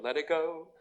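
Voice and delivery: lightly lilting movie-heroine line; a hint of a Disney princess; Elsa. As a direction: softly echo a film song with a rising end. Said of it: "like Elsa in Frozen".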